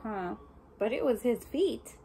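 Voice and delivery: ordinary delivery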